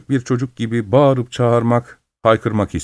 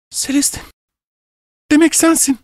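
very high, strained gasp of recognition; astonished, pitched far above usual; stunned recognition. A narrator's reading. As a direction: say it high, as if the truth just hit. Sudden recognition of lost sibling.

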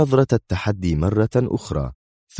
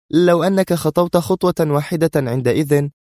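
high, cutting threat; sharp; 11 semitones higher than usual. A narrator's reading. Sonia rebukes her eager bodyguard, sharp. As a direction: warn him high and heated. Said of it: "قالت في حدة".

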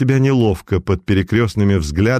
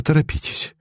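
quick urgent whisper; urgent hushed hurry; 5 semitones higher, 4 dB quieter than usual. A narrator's reading. Doctor urgently hushing the girl to hurry. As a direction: press her to hurry, under the breath.

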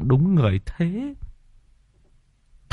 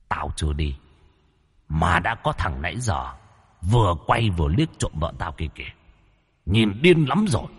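hollow booming voice; 4 semitones lower, 6 dB quieter than usual. Acted. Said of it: "cái vong mới kêu lên".

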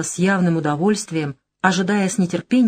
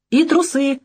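angry snap; 8 semitones higher than usual. Acted. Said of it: "зло кинула Илона".

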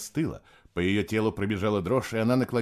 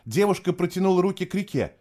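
usual speaking voice

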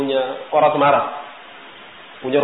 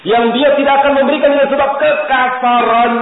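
"kekasaran" delivered loud and high; preaching climax; 9 semitones higher, 5 dB louder than usual. Real, not acted. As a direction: drive the point home.

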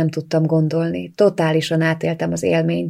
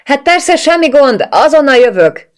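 loud, much higher, forced-cheerful shout; 9 semitones higher, 8 dB louder than usual. A narrator's reading. Bianca shouts to Katie through the door, fake cheerful. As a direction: yell out brightly to cover the panic. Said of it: "kiáltottam ki".

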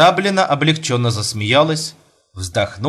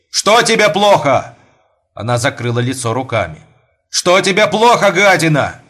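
angry yell; 4 semitones higher, 4 dB louder than usual. Acted. Husband yells at wife.

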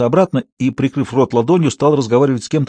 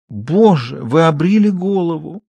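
slow, higher stage tremble; trembling voice; 4 semitones higher, slower than usual. Acted. Klara, trembling, laments her husband's shaved head. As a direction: let the voice quiver for drama. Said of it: "Голос Клары задрожал".

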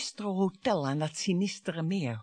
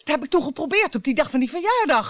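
raised voice; 6 semitones higher, 6 dB louder, faster than usual. Acted. Wife confronts husband, raising voice.

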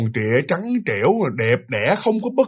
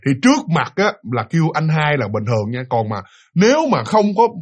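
ordinary talk

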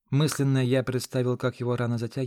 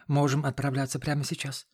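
usual speaking voice

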